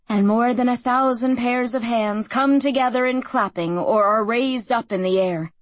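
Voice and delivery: plain reading voice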